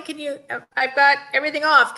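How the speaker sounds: normal talking voice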